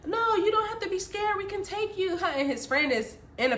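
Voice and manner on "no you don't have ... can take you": high-pitched mock persuasion; high, insistent false reassurance; coaxing stranger. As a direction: imitate a man wheedling from a car. Voices stranger coaxing from truck.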